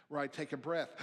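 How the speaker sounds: ordinary talk, nothing marked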